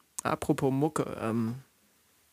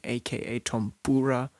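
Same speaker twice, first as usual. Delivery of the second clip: ordinary delivery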